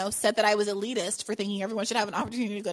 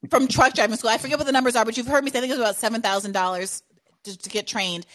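ordinary talk

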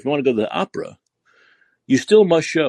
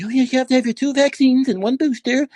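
higher, calmly recited put-on clerk voice; mock calm clerk; 8 semitones higher than usual. Host imitates the opera woman calmly stating the vaccine rule. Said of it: "Very calmly".

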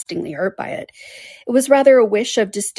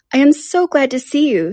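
higher, louder, gushing welcome; effusive greeting; 7 semitones higher, 5 dB louder than usual. A narrator's reading. Mrs Palmer greets the Dashwood sisters, effusive. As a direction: greet them with eager delight.

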